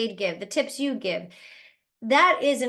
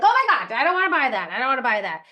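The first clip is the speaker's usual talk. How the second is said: mock exasperated outburst; 6 dB louder, faster than usual. Prospect dodging a pushy network marketer, mock exasperated. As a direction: play a prospect sick of being pitched. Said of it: "they're like".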